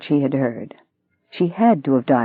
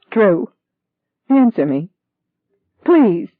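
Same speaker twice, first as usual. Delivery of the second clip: high, loud pleading, close to tears; half crying, half shouting; 7 semitones higher, 5 dB louder, slower than usual. Acted. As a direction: beg him, shouting through tears. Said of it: "She was half crying, half shouting".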